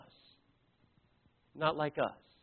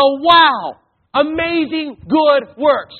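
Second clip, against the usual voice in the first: a hammed-up, amazed onlooker's shout; mock wow; 9 semitones higher, 10 dB louder, much slower than usual. Preacher mocking how people would gush over good works, loud slow exclamation. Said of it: "Here's what the Bible says".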